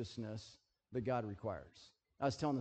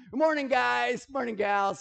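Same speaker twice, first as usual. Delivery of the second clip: cheery greeting; 9 semitones higher, 9 dB louder than usual. Retold or imitated. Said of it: "I say".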